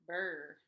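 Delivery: a mock cold shiver; cold shiver brr. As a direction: shiver as if freezing.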